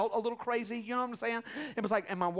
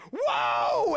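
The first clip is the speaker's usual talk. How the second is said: high, loud startled shout; nurse shouting; 12 semitones higher, 7 dB louder than usual. Retold or imitated. Nurse shouting in surprise as the baby arrives, loud. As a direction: yell it as the baby suddenly comes. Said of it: "the nurse is like".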